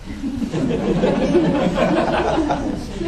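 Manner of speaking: soft amused chuckle. Real, not acted. Preacher laughing at his banker joke, soft chuckle. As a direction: laugh at your own joke.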